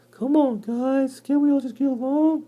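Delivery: hammed-up peacemaker spider; pleading cellar spider. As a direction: beg everyone to get along.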